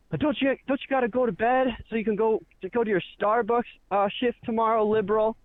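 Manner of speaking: high-pitched heckler's taunt; mocking. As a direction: imitate a big shot jeering at someone. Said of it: "mocking me".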